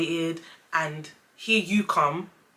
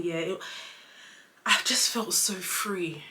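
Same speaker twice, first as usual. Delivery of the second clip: ordinary delivery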